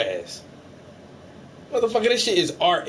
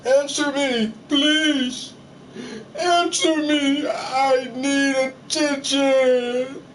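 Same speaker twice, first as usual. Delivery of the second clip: slow, higher pleading whine; needy whine; 8 semitones higher, much slower than usual. Pretend. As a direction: beg for attention like a needy kid. Mimics needy viewer, whiny.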